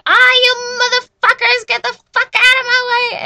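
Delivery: high, very loud screeching road rage; screechy mom yell. Retold or imitated. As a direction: imitate mom yelling at the top of her lungs. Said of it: "a screechy voice".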